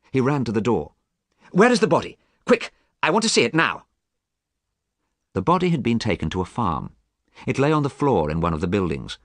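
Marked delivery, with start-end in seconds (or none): 1.5-3.8 high, loud and urgent demand; excited urgency. A narrator's reading. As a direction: rush them to the body, eager. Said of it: "Suddenly he was excited and hopeful".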